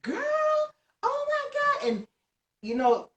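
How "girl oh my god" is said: friends' excitement. Retold or imitated. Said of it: "they were, like, calling me on video chat".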